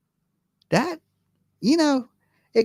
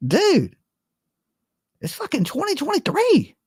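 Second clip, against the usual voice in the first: high exasperated retort; 11 semitones higher than usual. Retold or imitated. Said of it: "I'm like".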